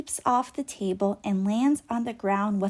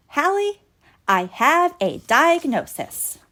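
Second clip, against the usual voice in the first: louder, proud announcement; announcing; 5 semitones higher, 6 dB louder than usual. A narrator's reading. Doc announces to Hallie, louder proud call. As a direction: declare it to the room. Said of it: "and then announces".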